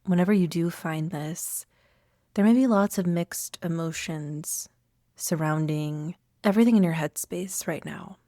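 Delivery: unhurried meditation guide with long pauses; calm, spacious meditation voice; guided meditation. Acted. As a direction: lead listeners gently inward, leaving room to breathe.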